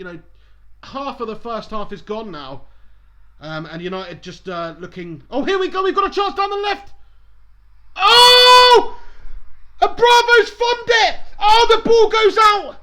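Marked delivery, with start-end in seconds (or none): 5.3-12.8 high, very loud commentary screams; excited shouting. Real, not acted. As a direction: yell as the chance unfolds. Live shout at a chance.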